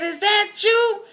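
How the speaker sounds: high, loud, slow call across the yard; hollering out. Pretend. Neighbor lady yells down to John Henry caught outside, high and loud. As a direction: yell the question down from the window.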